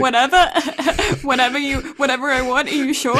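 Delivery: delighted she got her way; gleeful laughing. Retold or imitated.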